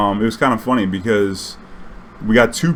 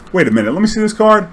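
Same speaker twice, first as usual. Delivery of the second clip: high and quick, suddenly alert; surprised; 9 semitones higher, 4 dB louder, faster than usual. Retold or imitated. Player rereading the opponent's card, suddenly alarmed and fast. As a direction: snap to attention over a card.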